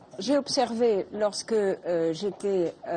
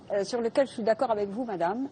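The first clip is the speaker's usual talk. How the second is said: usual speaking voice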